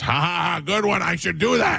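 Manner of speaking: high, lightly voiced fake laugh, good sport; a hint of Sandler brushing it off kindly; Sandler polite laugh. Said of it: "Adam Sandler goes".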